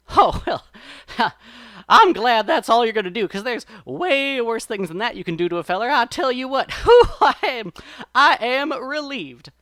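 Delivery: high, loud rabbit voice full of laughing relief; feigned relief. A narrator's reading. Brer Rabbit bluffs the fox holding him, loud showy relief. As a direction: exaggerate how relieved you are.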